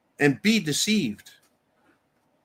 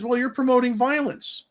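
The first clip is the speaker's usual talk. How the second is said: mocking whiny governor; 9 semitones higher than usual. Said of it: "He throws a fit and says".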